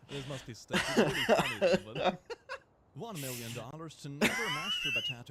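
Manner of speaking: loud laughter through the words; can't stop cracking up; laughing hard. Real, not acted. Cracking up at a meme.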